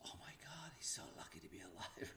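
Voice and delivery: hushed whisper of shock; breathless whispered oh my God; whispered gasp. Retold or imitated. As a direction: whisper it in mock horror.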